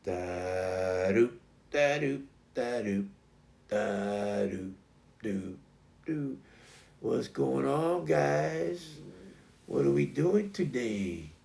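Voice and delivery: slow, easygoing dog voice; laid-back, drawn-out greeting; Tango. Retold or imitated. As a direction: amble along lazily, humming softly then drawling. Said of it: "Tango was more like".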